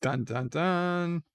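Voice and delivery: slow mock suspense sting sung out; dramatic sting. Recapping the empty quarters, slow mock sting. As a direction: hum it like a cheesy TV cliffhanger.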